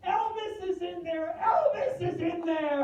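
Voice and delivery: put-on high, wailing old woman, half laughing; hammed-up hysterical granny with a laugh in it; hysterical old lady. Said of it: "this poor old woman was hysterical".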